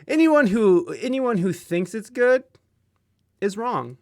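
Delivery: loud, high mock indignation; mock outrage. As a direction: rant it with playful outrage. Mock outrage rant on pineapple.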